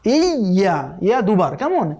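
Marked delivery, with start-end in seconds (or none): none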